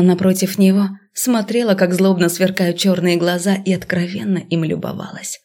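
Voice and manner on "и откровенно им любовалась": plain reading voice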